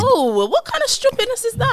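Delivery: offended by the question; shocked high outburst; indignant shock. Real, not acted. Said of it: "I'm just asking".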